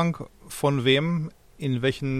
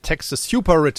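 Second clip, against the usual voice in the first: louder, higher mock rallying cry; mock Bernie slogan; 4 semitones higher, 5 dB louder than usual. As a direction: ham up the slogan. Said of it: "sagt er jetzt".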